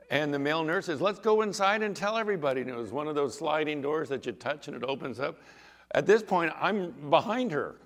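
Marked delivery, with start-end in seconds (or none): 1.0-2.6 high, loud excited quote; excited urging. Retold or imitated. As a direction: urge everyone on with raised voice. Male nurse amazed at the healing.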